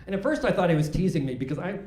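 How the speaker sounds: ordinary talk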